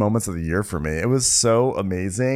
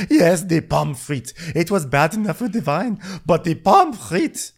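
put-on German accent; 8 semitones higher than usual. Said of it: "I'm doing, like, a German accent".

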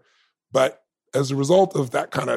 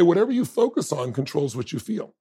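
ordinary delivery

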